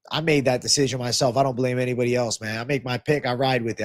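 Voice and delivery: normal talking voice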